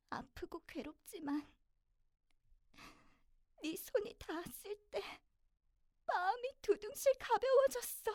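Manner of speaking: high, building tearful confession; overwhelmed with emotion. Acted. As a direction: climb to a loud, high emotional peak. Acted emotional confession, pain and relief.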